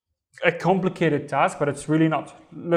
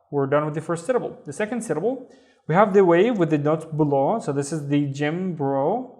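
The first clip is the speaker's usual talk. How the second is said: ordinary talk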